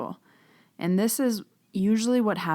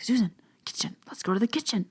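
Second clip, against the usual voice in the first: unvoiced, higher urgent whisper; stage whisper; 5 semitones higher, faster than usual. Trying to lure Susan to the kitchen, urgent whisper. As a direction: hiss the invitation across the table. Signaling Susan across the table.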